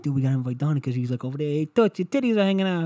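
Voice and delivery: put-on fast, high Italian-accented buddy voice; quick hammed-up Italian accent. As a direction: play a brash Italian guy calling out. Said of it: "he'll fucking have an Italian accent".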